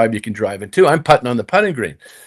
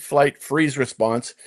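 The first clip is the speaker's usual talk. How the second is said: usual speaking voice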